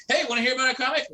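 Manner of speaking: eager pushy vendor. Retold or imitated. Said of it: "it's very sort of intimidating to be like".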